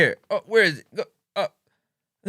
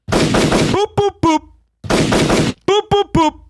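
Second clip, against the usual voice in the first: high rapid boops; vocal horn volley; 7 semitones higher, much slower than usual. Pretend. Hype man salutes the show, high horn volley. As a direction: fire off horn noises in threes. Imitating horn to greet a viewer.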